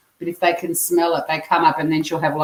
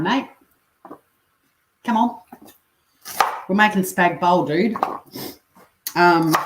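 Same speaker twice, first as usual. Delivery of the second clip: ordinary talk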